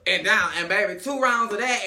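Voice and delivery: normal talking voice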